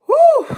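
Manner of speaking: high, loud exasperated whew. Real, not acted. Overwhelmed by the message, a high loud exasperated whew. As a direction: blow it out in disbelief.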